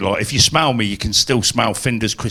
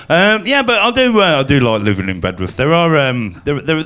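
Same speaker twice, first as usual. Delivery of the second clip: ordinary delivery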